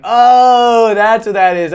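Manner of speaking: loud, high dawning "oh"; mock realization. Viewer finally getting the slang, high loud realization. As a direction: let the penny drop loudly. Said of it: "you're like".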